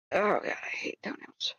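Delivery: whispered aside; whispering. Real, not acted. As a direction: breathe it under your breath. Aside while muting the stream.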